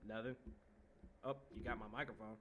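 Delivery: usual speaking voice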